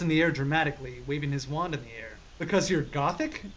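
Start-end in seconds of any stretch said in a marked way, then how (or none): none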